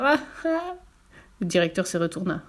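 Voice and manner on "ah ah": high, light acted laugh; high "ah, ah" chuckle; guitar's laugh. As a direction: laugh gleefully in character. Guitar laughs at fooled director.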